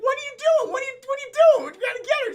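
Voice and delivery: high, loud exasperated shouting; mock yell. Henchman yells at his partner, shouting. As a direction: yell at a partner gone crazy.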